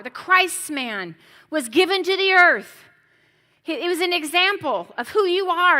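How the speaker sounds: loud, high, ringing sermon delivery; fervent pulpit preaching; full-force preaching. Acted. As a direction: preach it out to the congregation.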